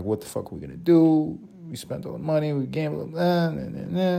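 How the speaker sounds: a hint of panicked broke family whining; dejected family. Broke family voiced in the story.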